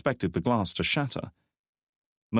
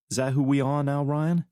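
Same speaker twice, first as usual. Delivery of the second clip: higher woman's voice, troubled; a woman questioning her friend; McKnight's voice; 7 semitones higher than usual. A narrator's reading. McKnight challenging Drake's threat, higher woman's voice.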